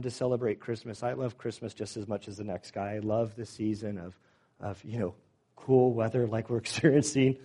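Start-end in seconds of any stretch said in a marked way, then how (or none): 5.5-7.4 louder, tongue-in-cheek with a chuckle; joking irony, a laugh breaking in; sarcastic. Real, not acted. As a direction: say it with playful sarcasm, half laughing. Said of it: "That's sarcasm".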